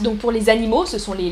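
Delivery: ordinary talk, nothing marked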